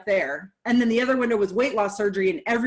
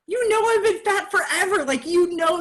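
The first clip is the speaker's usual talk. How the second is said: incredulous retort; 8 semitones higher, 3 dB louder than usual. Retold or imitated. Retelling what she thought to sister.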